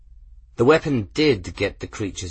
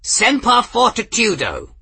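much higher, loud battle cry; bellowed; 14 semitones higher, 7 dB louder than usual. A narrator's reading. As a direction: bellow the motto like a war cry. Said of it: "bellowed Master Ferris".

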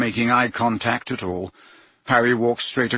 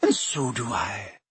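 kindly; 5 dB quieter than usual. A narrator's reading. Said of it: "said Professor McGonagall kindly".

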